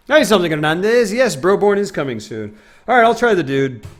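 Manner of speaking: a hint of the Dude; Jeff Bridges impression. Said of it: "that great Jeff Bridges voice".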